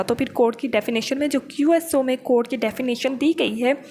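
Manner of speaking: ordinary talk, nothing marked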